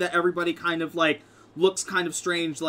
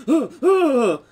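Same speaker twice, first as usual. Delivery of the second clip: scared cry; 9 semitones higher, 10 dB louder than usual. Acted. Players scared by aura of fear.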